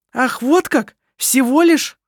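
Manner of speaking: high, loud sneering retort; loud high sarcastic scoff; scornful snort. A narrator's reading. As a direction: scoff at her sister. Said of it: "фыркнула Керстин".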